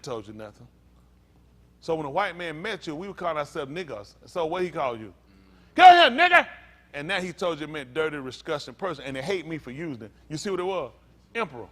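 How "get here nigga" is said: high, very loud order; imitated master's yell; mock commanding shout. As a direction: holler a harsh summons, acting it out.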